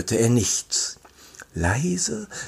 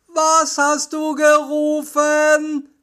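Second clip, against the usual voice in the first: slow, high-pitched shouted question; calling out; 13 semitones higher, slower than usual. A narrator's reading. Peter calling to Bob, slow high calling. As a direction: call it slowly so it carries. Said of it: "Peter rief".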